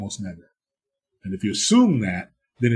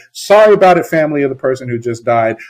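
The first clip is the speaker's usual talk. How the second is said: mock flippant apology; 8 dB louder than usual. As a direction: toss off the apology callously.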